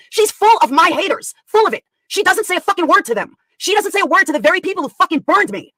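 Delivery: angry rant. Real, not acted. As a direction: fire off loud, indignant complaints. Livestream rant about friend's betrayal.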